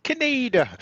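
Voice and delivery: Canada sung out high and bright; a high, playful echo of Canada; mock-excited Canada. Host echoes with high voice.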